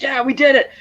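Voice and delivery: high-pitched retold cheer; happy cheering. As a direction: imitate a jubilant cheer, lightly. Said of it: "Seth is so happy, cheering".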